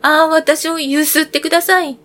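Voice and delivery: a tree begging aloud to be shaken; loud call. Acted. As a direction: call out to the passing girl. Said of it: "木が大声で呼びかけました".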